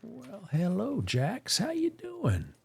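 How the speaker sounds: high, soft sing-song for the horse; sweet pet voice. Real, not acted. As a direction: coo a hello to your horse. Greeting his horse Jax.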